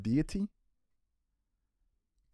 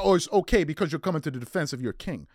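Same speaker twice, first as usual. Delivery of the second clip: usual speaking voice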